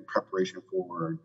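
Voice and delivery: normal talking voice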